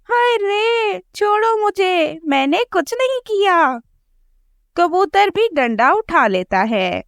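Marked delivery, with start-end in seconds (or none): 0.1-3.8 higher, mock-tearful wailing; wailing crow wife. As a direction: whine to be untied. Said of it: "गोरी कौवी रोने लगती है".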